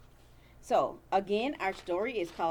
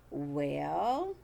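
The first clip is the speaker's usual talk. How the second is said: ordinary delivery, nothing marked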